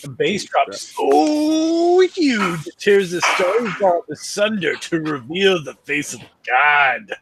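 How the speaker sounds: dramatic reading. Pretend. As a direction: read it like a movie trailer. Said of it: "I like the way you read that".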